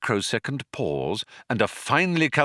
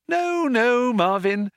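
high, sing-song reassurance; humouring, musical soothing; lilting; 4 dB louder than usual. A narrator's reading. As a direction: lilt it with forced brightness. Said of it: "lilted Trillian".

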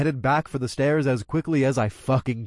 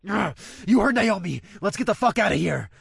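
urgent shout; 7 semitones higher than usual. Acted. Urgent escape from collapsing building.

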